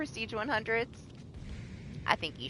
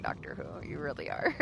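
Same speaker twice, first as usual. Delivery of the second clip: soft unvoiced whisper; a murmured teasing aside; whispered playful jab; whispered tease; 10 dB quieter than usual. Real, not acted. Teasing aside about opponent.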